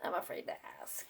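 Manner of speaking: fast nervous whisper; fearful whisper. Acted. Gerald confessing to Piggy, fearful whisper. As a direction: whisper it in dread.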